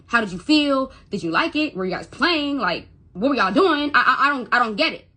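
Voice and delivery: worked-up, confused questions; retold freaking out. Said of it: "Freaking out, 'cause I don't know".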